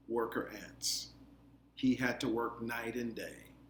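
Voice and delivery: usual speaking voice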